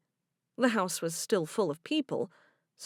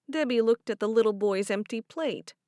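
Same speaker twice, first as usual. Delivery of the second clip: ordinary delivery, nothing marked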